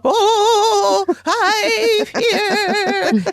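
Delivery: high, loud, ghostly medium wail; spooky medium voice. Retold or imitated. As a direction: wail it like a spooky old recording. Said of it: "And then she'll go".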